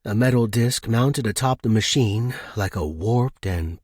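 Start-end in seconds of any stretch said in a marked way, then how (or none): none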